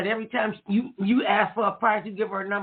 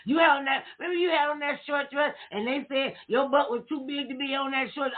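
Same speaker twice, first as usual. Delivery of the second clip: mock teasing kids; 7 semitones higher than usual.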